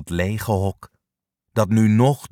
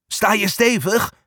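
calling out; 9 semitones higher than usual. A narrator's reading. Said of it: "roept hij".

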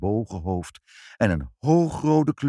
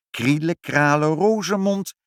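confident spell; 6 semitones higher, 5 dB louder than usual. A narrator's reading. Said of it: "Als ik de toverspreuk maar zeg".

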